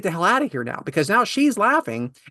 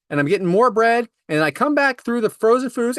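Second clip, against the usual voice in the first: ordinary delivery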